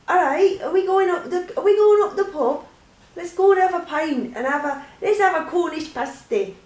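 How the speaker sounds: high, loud put-on English pub-goer's accent; English regional accent. Inviting someone up the pub, hammed-up English regional accent. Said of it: "It all changes into different accents".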